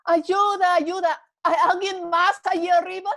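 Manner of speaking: high loud shout for help; comic punchline, shouting for help. Acted. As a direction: yell up for someone else. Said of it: "finalmente Jack gritó".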